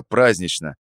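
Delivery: ordinary delivery, nothing marked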